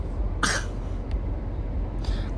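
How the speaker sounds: long tired breath out; weary sigh. Retold or imitated. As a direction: let out a drawn-out, tired sigh. Retelling irritating customers.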